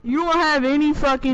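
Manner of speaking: loud, heated squabbling; heated protest. Real, not acted. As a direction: argue back loudly over the others. Arguing over claim to Ragnar.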